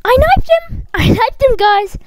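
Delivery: high, loud insistence; indignant yell. Real, not acted. Player insisting to viewers the knife hit, indignant yell. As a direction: protest loudly, pitch shooting up. Insists the kill was valid.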